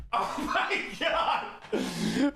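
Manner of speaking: high, breathless laughing disbelief; real stunned delight, squeaking up high; amazed laughing. Real, not acted. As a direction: draw it out slowly through an astonished laugh. Amazed the tool worked.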